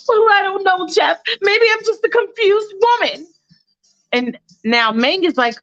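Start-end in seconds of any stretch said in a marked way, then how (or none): 0.1-3.3 high, flustered mother voice; a confused mom fumbling for her things; mock confused mother. Mom figure in retold skit.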